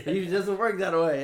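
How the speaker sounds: high, loud acted argument; indignant objection. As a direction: argue back, pushing pitch and volume up. Acted quarrel between cop and mother.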